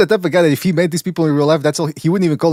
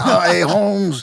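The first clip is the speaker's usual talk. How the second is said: slow, stretched Chicano slang greeting; hammed-up Chicano accent; mock Chicano accent; 2 semitones higher, much slower than usual. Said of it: "the way he tells it is like".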